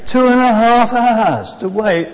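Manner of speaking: loud, drawn-out complaint; dismayed exclamation. Retold or imitated. Preacher tells congregation about missing the coach, loud dismay. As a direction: exclaim slowly at the long wait.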